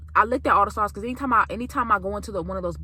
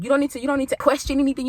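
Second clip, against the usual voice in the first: ordinary talk, nothing marked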